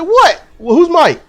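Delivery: baffled. Retold or imitated. Acted baffled reply to clerk.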